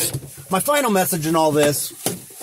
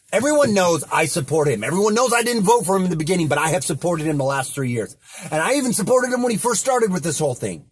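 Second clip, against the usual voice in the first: ordinary talk, nothing marked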